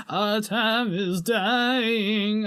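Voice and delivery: slow mock whimper. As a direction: whine it out slowly. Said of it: "whimpering voice".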